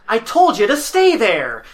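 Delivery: dorky scolding voice, drawn out slowly; slow, nerdy telling-off; Greg. Retold or imitated. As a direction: scold like a dorky middle school teacher. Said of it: "in his most dorky Greg ... Greg voice".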